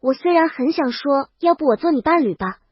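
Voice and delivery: ordinary delivery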